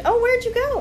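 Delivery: put-on high, sweet little girl asking. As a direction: pitch it up like a small child. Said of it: "the little girl said".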